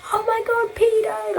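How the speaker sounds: very high imitated yell, held back in volume; high-pitched shouting impression without real volume; mock shout. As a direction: imitate a shouted accusation in a squeal. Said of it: "he fully shouted".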